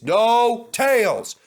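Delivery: loud, slow firm command. Acted. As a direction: cut them off, firmly. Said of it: "said Skarloey firmly".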